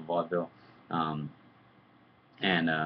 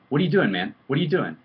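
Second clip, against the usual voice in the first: raised, loud demanding challenge; imitated stern dressing-down; retold confrontation; 9 semitones higher, 9 dB louder than usual. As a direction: act out grilling someone, high and loud. Acted confrontation of the scared kid.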